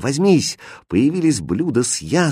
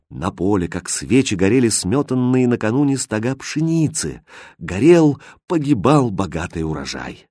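plain reading voice

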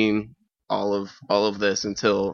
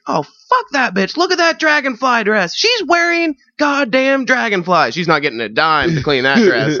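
catty disgusted friend; 12 semitones higher, 4 dB louder than usual. Retold or imitated. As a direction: sneer and screech at the dress. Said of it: "She's like".

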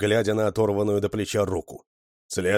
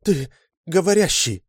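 high, louder cry of amazement; astonished gasp; 10 semitones higher, 5 dB louder than usual. A narrator's reading. Woman discovers the creature can talk, high astonished cry. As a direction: exclaim in shock, voice leaping up. Женщина охнула.

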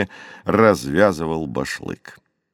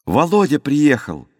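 shout; 6 semitones higher than usual. A narrator's reading. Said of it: "крикнул кто-то на дворе".

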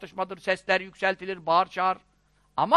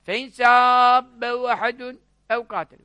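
Arabic recitation; much faster than usual. Acted. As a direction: recite the Arabic with long held vowels.

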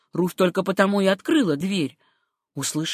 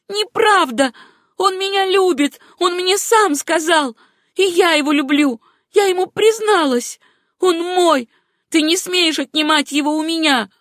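high, loud, heated denial; impassioned, possessive outburst; frantic protest; 10 semitones higher, 5 dB louder than usual. A narrator's reading. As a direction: fight for him in a raised voice. Acted quarrel over a lover.

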